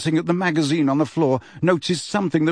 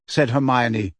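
ordinary delivery

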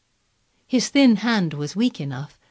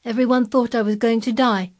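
put-on higher, louder boy's remark; curt; 6 semitones higher, 5 dB louder than usual. A narrator's reading. Colin answering the old gardener, curt. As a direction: say it shortly, voice lifted. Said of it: "said Colin shortly".

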